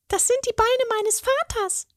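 very high fairy voice, proud. A narrator's reading. Fairy shows the troll her father's legs, high and proud. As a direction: show off a treasure. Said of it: "sagte sie stolz".

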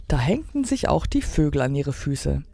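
plain reading voice

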